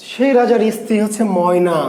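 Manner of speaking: usual speaking voice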